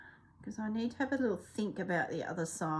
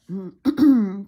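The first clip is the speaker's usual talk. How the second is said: throat clearing; 13 dB louder than usual. Real, not acted. Throat clearing mid-talk.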